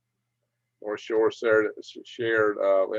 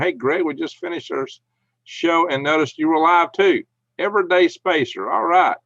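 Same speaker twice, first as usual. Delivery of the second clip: excited; 6 semitones higher, 7 dB louder than usual. Real, not acted. Reading chat, greeting viewer.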